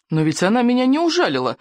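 heated objection. A narrator's reading. Boy denying he was bitten, heated objection. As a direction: object hotly, pitch climbing. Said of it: "горячо возразил рыбьенок".

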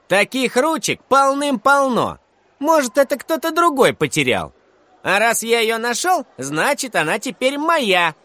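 put-on high, insistent kid voice; defiant schoolboy. A narrator's reading. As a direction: argue your claim like a cocky kid. Boy argues pen is now his.

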